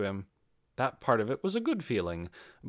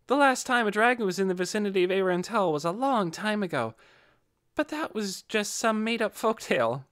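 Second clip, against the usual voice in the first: higher, eager know-it-all; lightly voiced chatty girl; 8 semitones higher than usual. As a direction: rattle off the lore, pleased to know it. Another character explains dragon lore.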